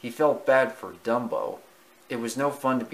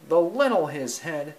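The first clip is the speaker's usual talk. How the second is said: usual speaking voice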